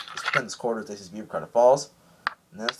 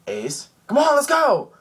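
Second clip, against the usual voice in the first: high, loud imitation of a hero calling; urgent call; 7 dB louder, slower than usual. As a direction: yell to a friend to get moving. Luffy calling to Ace.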